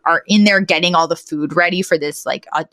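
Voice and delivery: usual speaking voice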